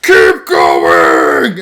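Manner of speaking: deep loud shouted command; retold order. Streamer voicing the dying soldier urging others on, deep shout. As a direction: yell the order while being eaten. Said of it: "And he's like".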